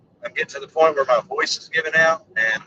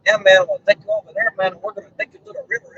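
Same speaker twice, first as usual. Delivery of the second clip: higher, quick mock Spanish-accented English; 6 semitones higher, faster than usual. Vlogger imitating people he talks to, mock Spanish accent. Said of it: "talk to people with an accent".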